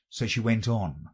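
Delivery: ordinary delivery, nothing marked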